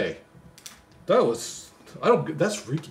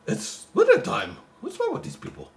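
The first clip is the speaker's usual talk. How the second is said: incredulous. Acted. Mock outrage at poem.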